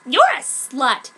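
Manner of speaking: dumb insult. Acted. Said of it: "she said stupidly".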